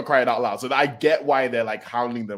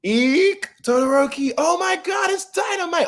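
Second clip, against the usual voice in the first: much higher squealing shout; fangirl squeal; 4 dB louder than usual. Retold or imitated. First years spotting their heroes, fangirl squeal. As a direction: squeal like a starstruck fan.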